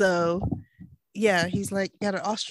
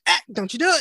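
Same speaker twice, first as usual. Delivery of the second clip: warning voice; 6 semitones higher, 7 dB louder, faster than usual. Pretend. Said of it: "my lower back is like".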